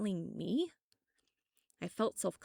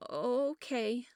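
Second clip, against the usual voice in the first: sniffling; 6 semitones higher, 4 dB louder than usual. A narrator's reading. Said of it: "Sarah sniffled".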